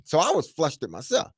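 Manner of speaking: ordinary talk, nothing marked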